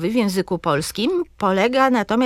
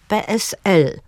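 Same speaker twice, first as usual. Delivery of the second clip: "P S L" as pe-es-EL; stress last syllable. Shown as a demonstration. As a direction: spell out "P S L", leaning on the final letter. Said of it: "akcentujemy zawsze na ostatniej sylabie". („P S L” z akcentem na EL)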